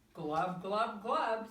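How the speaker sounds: loud glub sinking sound; duckling glub. A narrator's reading. Harold sinking, loud glub noises. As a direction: gurgle it out.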